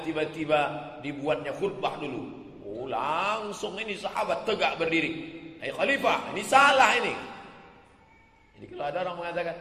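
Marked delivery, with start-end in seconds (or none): 5.6-7.1 public rebuke. Retold or imitated. Companion rebukes caliph publicly.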